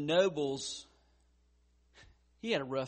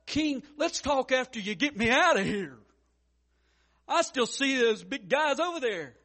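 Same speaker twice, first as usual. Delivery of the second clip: pretend pleading Daniel; 9 semitones higher, 8 dB louder than usual. Imagined Daniel pleading from den.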